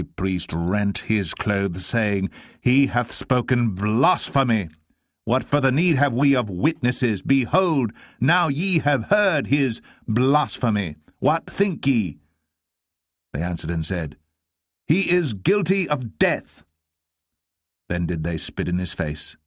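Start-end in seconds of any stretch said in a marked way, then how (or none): 2.6-12.2 high, slow, emphatic denunciation; outraged high priest. Acted. High priest cries blasphemy. 14.9-16.6 very high, slow, emphatic verdict; condemning cry. Acted. Council shouts its verdict.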